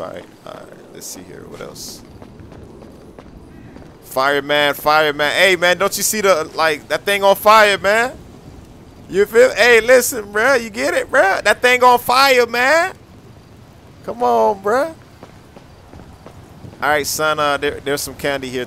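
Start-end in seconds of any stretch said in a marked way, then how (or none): 4.0-14.9 loud, high call across the room; raised voice hailing a costumed prop. Real, not acted. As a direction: shout over to the fireman figure to get his attention. Yelling at the fireman prop.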